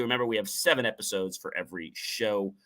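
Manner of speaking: normal talking voice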